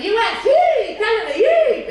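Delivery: high, squealed yay for each country; imitating the crowd's cheering; mock cheer. Singer mimicking how the passengers cheered. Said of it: "Did you see how it went?".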